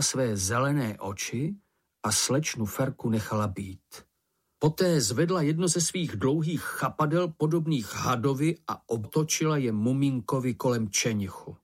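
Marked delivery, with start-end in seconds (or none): none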